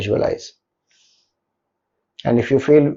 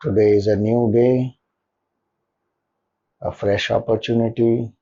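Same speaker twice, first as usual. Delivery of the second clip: soft, lower meditation voice with long pauses; guided meditation; 4 semitones lower, 6 dB quieter than usual. Acted. Leader settling listeners into a comfortable posture and gratitude, guided meditation. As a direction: guide them gently into stillness, leaving long silences between phrases.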